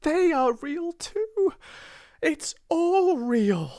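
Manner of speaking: very high, ecstatic outburst; joyful cry. A narrator's reading. Girl trying on the slippers, joyful cry. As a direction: cry out in amazed joy. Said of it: "she cried".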